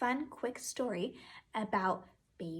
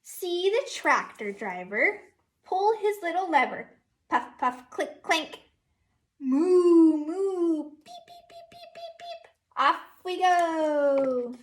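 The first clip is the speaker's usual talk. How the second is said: lively sing-song storybook read, a bit louder; teacher reading Down by the Barn to her class, sing-song; reading to children; 5 semitones higher, 7 dB louder, slower than usual. Acted. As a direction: read it like a storybook for little kids. Reads picture book aloud.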